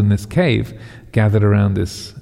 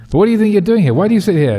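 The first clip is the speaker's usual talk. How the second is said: pitched well up and louder, incredulous; angry king; 10 semitones higher, 4 dB louder, faster than usual. Acted. King demanding why the yogi sits in the cave, high angry challenge. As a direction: bark the question down at him.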